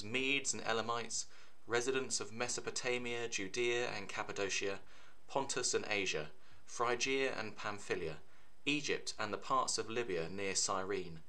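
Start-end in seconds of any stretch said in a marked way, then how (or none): none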